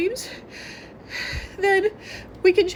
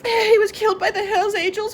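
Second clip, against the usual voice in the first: ordinary talk, nothing marked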